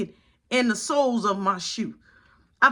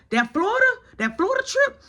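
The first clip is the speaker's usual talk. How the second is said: high, taunting lilt; mocking threat; 11 semitones higher, faster than usual. Mom threatening the kids' trip, high mocking taunt. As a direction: pitch it up to taunt the kids.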